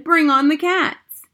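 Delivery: high, loud, drawn-out call; rallying shout. A narrator's reading. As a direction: call it out like a battle cry. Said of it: "I shouted".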